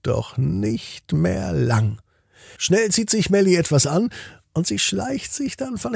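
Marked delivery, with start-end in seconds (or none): none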